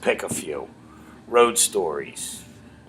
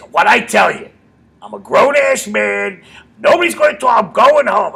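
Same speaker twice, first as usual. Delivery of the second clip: Artie Lang yelling; 11 semitones higher, 10 dB louder than usual. Retold or imitated. Comic imitating his boss yelling from a cab, indignant shout. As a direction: imitate an indignant man bellowing his defiance. Acted quote of Artie, window confrontation.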